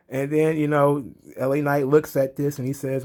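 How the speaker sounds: ordinary talk, nothing marked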